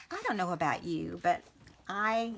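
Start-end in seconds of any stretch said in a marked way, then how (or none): none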